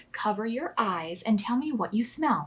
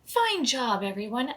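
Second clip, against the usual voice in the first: plain reading voice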